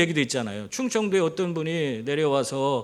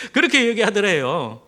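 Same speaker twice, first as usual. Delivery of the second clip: said through laughter, louder; chuckling through the words; laughing; 3 semitones higher, 6 dB louder than usual. Real, not acted. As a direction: still laughing as you finish. Laughing after the joke.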